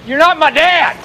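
angry rejection. Acted. Grown son disowning his father, angry. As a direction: disown him in anger.

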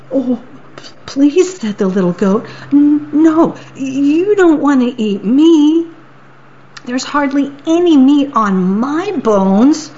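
high, stammering goat begging; pleading little goat. Acted. Little goat begs the troll not to eat him, high.

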